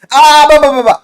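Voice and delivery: loud, high, mocking brush-off; dismissive blah blah. Brushing off viewers' Doki Doki objection, loud mocking blah blah. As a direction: wave the critics away with a sing-song blah.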